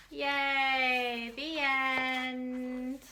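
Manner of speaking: ordinary delivery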